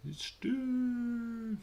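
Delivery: very high, drawn-out mock announcement; hyped entrance cry. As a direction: stretch it into a high held note. Mimicking a wrestler's big entrance announcement.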